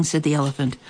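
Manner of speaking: ordinary delivery, nothing marked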